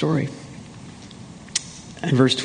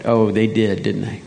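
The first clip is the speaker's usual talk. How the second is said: usual speaking voice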